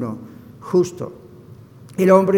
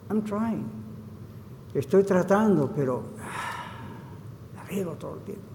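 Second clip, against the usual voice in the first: soft, downcast confession with a sigh; quiet, deflated reply, fading away; sheepish admission; 9 dB quieter than usual. Retold or imitated. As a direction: imitate an ashamed man, trailing to near silence. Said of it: "El hombre bajó la cabeza y dijo".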